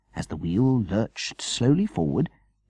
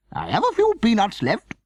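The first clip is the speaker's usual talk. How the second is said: Veronica voice; 5 dB louder than usual. A narrator's reading. Veronica character voice.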